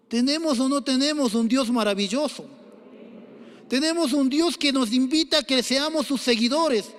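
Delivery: rising preaching fervor. Acted. Pastor urging his congregation with rising preaching fervor. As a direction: lift it high and press, a preacher rousing the church. Preacher building to full force.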